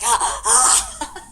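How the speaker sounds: loud, high mock cackle; imitated gleeful laugh. Imitates Evan's excited laughing.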